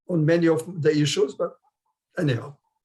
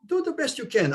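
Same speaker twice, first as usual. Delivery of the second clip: encouraging; 7 semitones higher than usual. Real, not acted. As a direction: gently urge a nervous volunteer, pitched up. Coaxing shy volunteers to speak.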